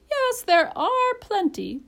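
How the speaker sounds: high, slow, drawn-out bird voice; parrot, conceding. A narrator's reading. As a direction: concede it loudly with drawn-out emphasis.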